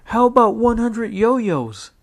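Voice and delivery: put-on high, loud kid voice pitching an idea; a bouncy boy blurting a suggestion; eager little brother. A narrator's reading. Tom at dinner to Jessica: high eager kid voice.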